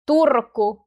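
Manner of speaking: "Turku" with a strong trilled r; forceful Finnish trill in "Turku"; stronger R. Shown as a demonstration. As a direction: roll the r hard in "Turku". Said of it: "R sounds stronger than in Tampere".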